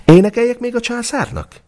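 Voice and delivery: very high, light birdlike voice; a tiny bird asking politely; nightingale. A narrator's reading. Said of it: "kérdezte a fülemüle".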